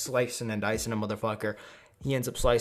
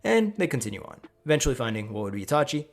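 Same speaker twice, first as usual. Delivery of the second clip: ordinary delivery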